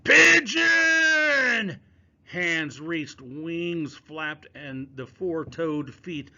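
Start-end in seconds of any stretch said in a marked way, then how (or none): 0.0-1.8 piercing high shriek; sudden shrill scream of panic. Acted. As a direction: scream the word out in alarm. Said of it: "shrieking mask".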